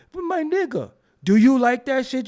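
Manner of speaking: imitating African dudes on Canal Street, African accent. African accent greeting, pitched high. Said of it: "some of them African dudes be like".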